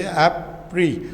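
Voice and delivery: एप्री slowly, split after the first syllable; held between syllables. Shown as a demonstration. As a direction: hold the tongue briefly midway through एप्री. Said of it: "ज़बान को hold करके". (एप्री को ज़बान रोक कर, दो हिस्सों में)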